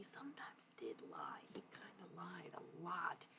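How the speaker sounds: hushed unvoiced whisper, confiding. Real, not acted. Telling the viewers his secret in a whisper. Confiding aside about the scientist's lies.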